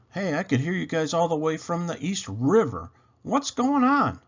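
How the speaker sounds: half-awake annoyed call; Carlos' voice. A narrator's reading.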